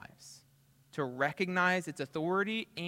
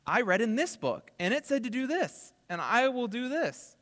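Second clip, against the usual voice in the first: higher and louder, fired-up conviction; eager zeal; 9 semitones higher, 4 dB louder than usual. Retold or imitated. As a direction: say it like a member who just can't wait to obey. Same quote, repeated with zeal.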